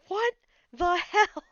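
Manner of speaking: high, loud, slow what the hell; mock scream. Fan reacting to new mod badge, high loud shriek. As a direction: shriek it in delighted shock. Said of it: "I screamed".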